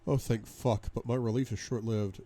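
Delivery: plain reading voice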